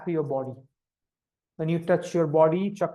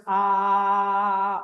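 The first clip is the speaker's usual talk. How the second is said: slow open ah pushed outward. Shown as a demonstration. Teacher demonstrating the outward sound. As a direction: send the ah out of you. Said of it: "I'm gonna show you the example".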